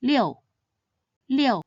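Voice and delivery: "Liu" with a sharp falling fourth tone. Shown as a demonstration. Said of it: "read in the fourth tone".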